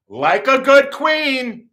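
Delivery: high, slow, drawn-out queenly delivery; camp flourish. Acted. Storyteller crowning his own shriek, camp flourish. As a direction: stretch it out high and proud.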